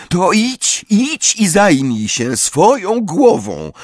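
high, drawn-out angry dismissal; slow, high-pitched acted anger. A narrator's reading. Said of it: "powiedziała ze złością".

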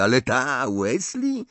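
mocking, very high-pitched question; scornful, squeaky incredulity; Malfoy. A narrator's reading. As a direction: sneer upward in disbelief. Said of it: "zadrwił Malfoy".

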